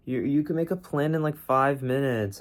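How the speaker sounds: high coaxing little voice tempting him back to bed; high wheedling voice of a sleepy brain; sneaky tired brain. Retold or imitated. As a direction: play the lazy inner voice, sweet and wheedling. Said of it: "the little sneaky little tired brain in the morning doesn't look at me and goes".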